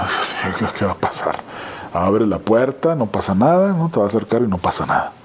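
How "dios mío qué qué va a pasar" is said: scared muttering. Retold or imitated. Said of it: "a la puerta y diciendo".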